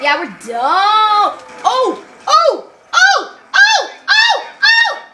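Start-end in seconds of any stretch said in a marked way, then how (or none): 1.6-5.0 each Oh climbs higher and louder; rising yells. Real, not acted. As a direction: panic yelps climbing in pitch. Dying in game.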